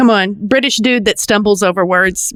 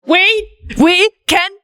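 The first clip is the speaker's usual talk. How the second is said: Jamie's line; 10 semitones higher, slower than usual. Retold or imitated. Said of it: "He goes".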